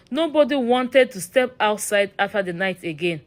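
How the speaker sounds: ordinary delivery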